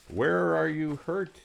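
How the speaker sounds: high, slow doctor's question; doctor asking. Acted. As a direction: ask it high and drawn out. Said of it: "he asked me".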